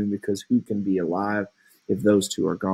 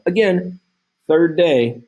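high, loud exclamation; emphatic teacher aside; exclaiming; 8 semitones higher, 5 dB louder, slower than usual. Real, not acted. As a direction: marvel at how fast it all happened. Teacher stressing the rushed timeline.

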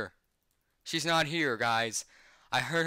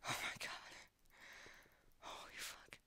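breathless whisper, barely voiced; real shock, all voice gone; shaken whisper; 19 dB quieter than usual. Real, not acted. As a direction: whisper it, stunned after a fright. Shaken after the jump scare.

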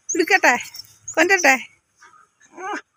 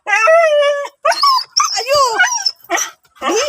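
scolding the pet; 13 semitones higher than usual. Real, not acted. Scolding the pet to move over.

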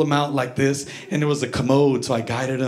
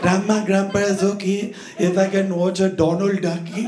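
put-on polite kid's Indian accent; young boy, thick Indian accent; childhood Indian accent; 3 semitones higher than usual. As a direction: plead for cartoons in an Indian accent. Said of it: "That was my accent".

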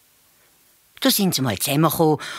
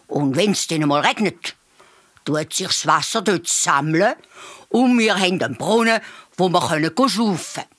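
fox's excited call, higher and louder at first; 4 semitones higher, 3 dB louder than usual. A narrator's reading. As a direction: call out a sudden idea to the group. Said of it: "auf einmal gerufen".

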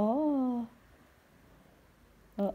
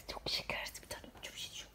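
soft unvoiced whisper, much quieter; 14 dB quieter than usual. Real, not acted. Mother whispering lovingly while kissing her pet's head. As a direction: whisper fondly so it stays asleep. Whispering over a sleepy pet.

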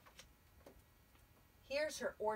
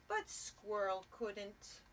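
usual speaking voice

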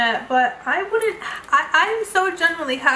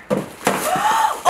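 high, sharp delighted gasp; big high intake of surprise; amazed gasp. Real, not acted. Amazed at the card.

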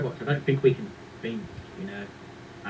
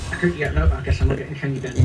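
ordinary talk

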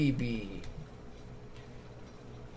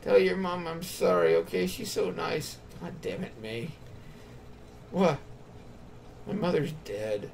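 normal talking voice

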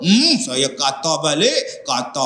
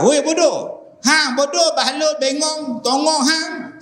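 high pitched string of insults; high escalating insults; 12 semitones higher than usual. Acted. Ustaz acts the man answering back with extra insults, high and mocking.

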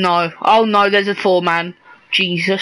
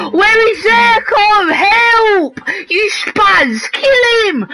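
high, loud panicked yells for help; frantic high shouts, half laughing; panicked shouting; 11 semitones higher, 3 dB louder than usual. Real, not acted. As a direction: scream for help as you're cornered. Panicked shouting during gunfight.

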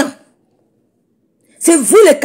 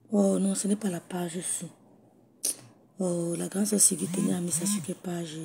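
whisper; 5 semitones lower, 16 dB quieter than usual. Real, not acted. Se parle à elle-même, perdue.

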